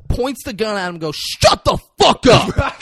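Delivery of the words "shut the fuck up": slow, high, loud yell; mock shout. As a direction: bellow it at the driver. Denzel yelling at honker.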